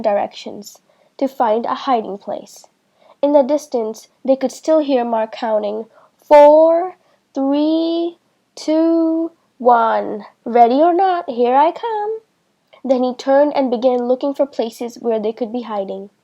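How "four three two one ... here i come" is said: sing-song seeker's call; calling out countdown. A narrator's reading.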